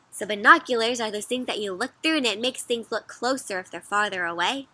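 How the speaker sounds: plain reading voice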